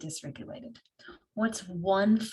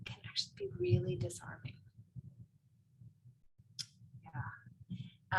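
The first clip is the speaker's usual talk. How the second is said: whisper; 11 semitones higher, 7 dB quieter than usual. Real, not acted. Soft aside while presenting.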